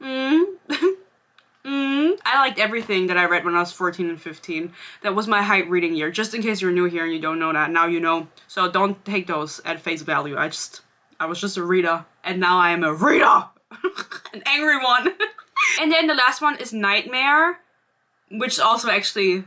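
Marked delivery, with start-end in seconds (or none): none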